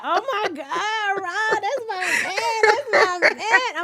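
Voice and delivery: very high, slow, laughing swoon; hammed-up squeal of adoration, words stretched out; imitating lovestruck gushing. Mora swooning over her man Rob, mock lovestruck gushing. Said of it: "her eyes are sparkling".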